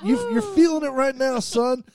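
imitating the bartender crushing him in a hug; hearty bartender. Bartender hugging the customer, teasing mock bellow. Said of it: "She goes".

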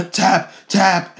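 high, loud mock submission cry; hammed-up urgent yell; acted frantic shout. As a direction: call it out like a trapped wrestler. Voicing wrestler submitting in match.

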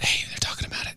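soft, unvoiced mock leak; whispered rumour. Candidates leaking to the media, mock secretive whisper. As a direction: whisper it like planted gossip. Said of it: "Yeah, they whisper it".

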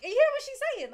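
high, loud incredulous question; retold outrage. Auntie asks niece about the shouting woman, high retold outrage. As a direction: imitate an incredulous auntie, pitched right up.

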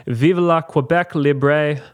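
slow, careful French pronunciation; French accent attempt. Shown as a demonstration. Host reading the quiz quote with a careful French accent. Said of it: "my French accent".

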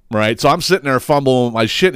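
usual speaking voice

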